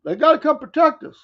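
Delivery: high, loud mocking whine of a boaster; mock boasting voice. Mocking a boastful country, high and loud mock whine. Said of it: "Then boasting that".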